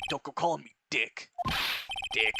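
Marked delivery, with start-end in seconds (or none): none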